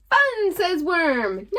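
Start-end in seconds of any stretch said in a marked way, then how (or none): 0.1-0.5 delighted fun. Acted. Worm delighted.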